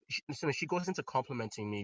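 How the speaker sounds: ordinary talk, nothing marked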